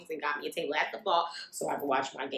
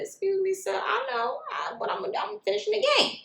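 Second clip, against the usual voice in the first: polite brush-off. Retold or imitated. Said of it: "I said".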